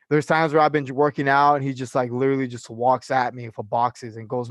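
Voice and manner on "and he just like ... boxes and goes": ordinary delivery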